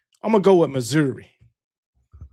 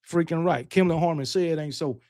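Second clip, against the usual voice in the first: ordinary talk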